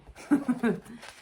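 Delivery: a happy little bear laugh; greedy chuckle. Acted. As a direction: chuckle with delight at the honey. Norman laughs at honey.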